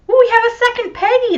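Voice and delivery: bright high surprised ooh; delighted surprise. Real, not acted. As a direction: squeal with delight at the news. Delighted by second Peggy viewer.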